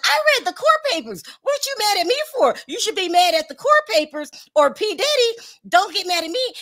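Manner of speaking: high, squeaky mock voice of an indignant blogger; mocking Akademiks. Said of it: "And D J Akademiks was like".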